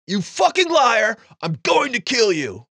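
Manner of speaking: high, loud enraged yelling down the phone; furious screaming. Retold or imitated. Madman screaming at girlfriend on phone, enraged shout. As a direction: scream at her like a betrayed madman. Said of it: "begins to scream at the person".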